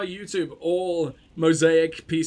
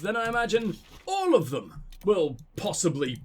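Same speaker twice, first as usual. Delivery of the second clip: normal talking voice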